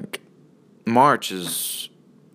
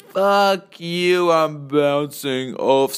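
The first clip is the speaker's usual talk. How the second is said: mock Jordan voice; 4 semitones higher, much slower than usual.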